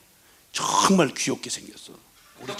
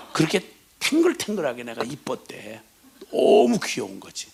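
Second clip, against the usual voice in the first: usual speaking voice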